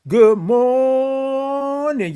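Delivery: high, long-held "morning", stretched on the first syllable; big high stretched-out morning greeting; drawn-out greeting. Real, not acted. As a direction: sing out "good morning", holding "mor-" very long. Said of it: "I love all them O's and R's and I N Gs".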